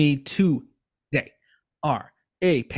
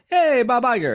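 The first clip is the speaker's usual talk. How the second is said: mock cheerful hey, higher; cheery greeting; 9 semitones higher, 4 dB louder than usual. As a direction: sing out a happy hello. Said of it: "we all went".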